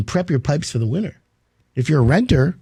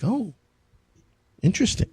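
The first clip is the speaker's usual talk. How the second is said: playing dumb; 5 semitones higher, 5 dB quieter than usual. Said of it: "play dumb".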